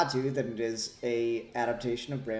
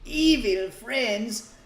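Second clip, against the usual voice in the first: high, slow spooky host voice; cackling Halloween ghoul; jack o lantern voice; 11 semitones higher, 9 dB louder, slower than usual. Acted. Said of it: "episode of Ghouly Gentlemen".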